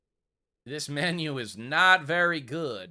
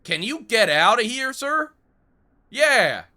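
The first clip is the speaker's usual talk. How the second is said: shouting at a customer; 5 semitones higher, 9 dB louder than usual. Acted. Shooing a customer out of store.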